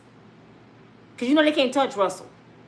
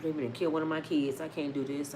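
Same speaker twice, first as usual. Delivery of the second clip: much softer, hushed and beaten down; 7 semitones lower, 10 dB quieter than usual. Retold or imitated. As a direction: barely get it out, like a broken man. [name] voiced, despairing about kids.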